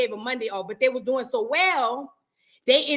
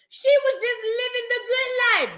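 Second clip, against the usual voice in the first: mock crying; 10 semitones higher than usual. Mock lament over Chrisean.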